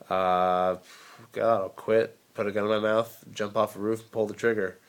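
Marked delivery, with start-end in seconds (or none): none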